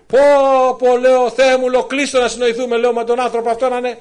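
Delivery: high, loud urgent prayer; pleading loudly to God to stop the music; excited plea. Retold or imitated. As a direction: beg God with rising excitement. Excited prayer retold at high pitch.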